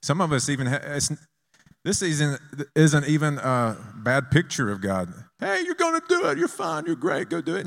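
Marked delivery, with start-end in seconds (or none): 5.4-7.7 very high put-on cheering voice; squeaky mock pep talk; mock cheerleading. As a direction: parody a far-off, overeager encourager. False picture of God cheering from afar.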